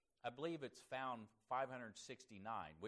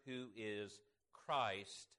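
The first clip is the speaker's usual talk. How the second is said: "Christ" drawn out slow and weighty; slow stress on Christ; much slower than usual. Shown as a demonstration. Preacher reading scripture to his class, slow emphasis on "Christ". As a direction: lean hard on "Christ", slowly. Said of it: "I'm gonna be emphasizing the word Christ".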